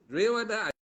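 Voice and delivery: a sudden loud, high burst of insistence; emphatic insistence. Real, not acted. Monk insisting to students it's real, loud emphatic exclaim.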